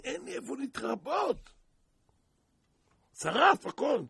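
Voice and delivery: stranded man's prayer turning to a wail; desperate prayer. Retold or imitated. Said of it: "התחיל לפנות הקדוש ברוך הוא".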